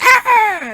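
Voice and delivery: high, yelping cat scream; a little cat shriek, mocking gamers. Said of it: "And a little cat scream".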